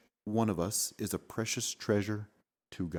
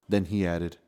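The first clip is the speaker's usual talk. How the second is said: plain reading voice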